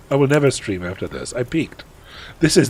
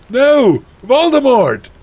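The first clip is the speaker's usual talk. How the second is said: high, loud melodramatic refusal; hammed-up defiant cry; shouting back; 13 semitones higher, 7 dB louder, slower than usual. Heroine refusing Voldemort's order, theatrical shout. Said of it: "I shouted back".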